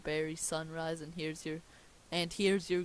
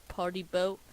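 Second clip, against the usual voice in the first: usual speaking voice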